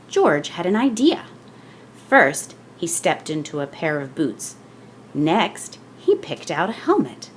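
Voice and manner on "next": ordinary delivery, nothing marked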